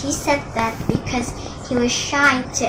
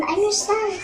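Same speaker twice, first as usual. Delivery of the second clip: fading plea; 9 semitones higher than usual. Acted. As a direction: say it again as the voice gives out. Repeated, less force.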